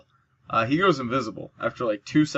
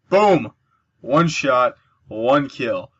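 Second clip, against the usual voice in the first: excited shout; 7 dB louder, much slower than usual. Real, not acted. Gamer cheering a kill, loud and excited.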